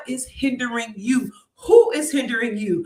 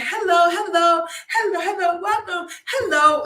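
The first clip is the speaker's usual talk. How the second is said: fake video greeting; 8 semitones higher, 4 dB louder than usual. Greeting her live viewers in a fake, high, bubbly voice. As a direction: put on a fake cheery livestream opener. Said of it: "It sounds so fake".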